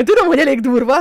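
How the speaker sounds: laughing, a bit loud and quick. Real, not acted. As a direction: laugh it out sheepishly. Embarrassed laugh about own boldness.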